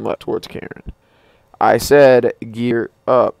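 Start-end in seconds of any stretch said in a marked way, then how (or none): 1.6-3.4 slow loud insistent order; annoyed command. Pretend. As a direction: spell it out, annoyed. Repeated command, slowed.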